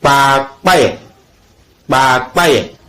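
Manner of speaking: "pa, paye" said as "kpakpaye"; K and P released together in "pa, paye"; combined KP example. Shown as a demonstration. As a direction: model "kpakpaye" with doubled-up stops. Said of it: "forment P, comme".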